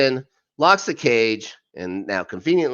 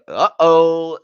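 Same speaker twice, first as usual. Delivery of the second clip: mock dread; 6 semitones higher, 10 dB louder than usual. Ominous plot turn.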